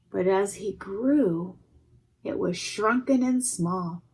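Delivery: ordinary delivery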